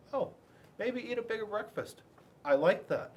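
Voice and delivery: plain reading voice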